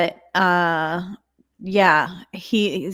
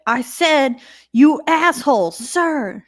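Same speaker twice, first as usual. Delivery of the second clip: high, louder mock-military retort; defiant retort; 7 semitones higher, 5 dB louder, slower than usual. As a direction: double down, pitched up and forceful. Repeats retold insult, doubling down.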